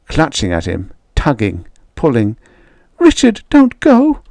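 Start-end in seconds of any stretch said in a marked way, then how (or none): 3.0-4.2 very high desperate begging; hysterical pleading. A narrator's reading. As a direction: beg him frantically, pitched way up. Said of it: "You're hysterical".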